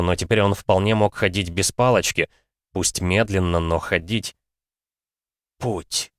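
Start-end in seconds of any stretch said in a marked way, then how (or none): 5.6-6.1 тихо, почти шёпотом; прошептать себе. Acted. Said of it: "прошептал старик". (старик тихо шепчет)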